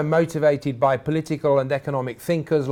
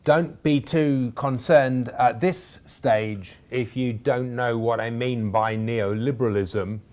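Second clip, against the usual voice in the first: normal talking voice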